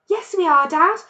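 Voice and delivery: high, loud, eager shout; cried. A narrator's reading. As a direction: cubs leaping to prove themselves. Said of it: "cried the small foxes".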